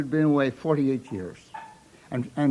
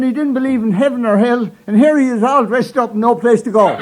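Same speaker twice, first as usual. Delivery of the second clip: punchline, louder and higher, breaking into a laugh; 7 semitones higher, 6 dB louder than usual. Retold or imitated. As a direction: deliver it with a chuckle.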